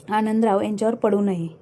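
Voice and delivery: plain reading voice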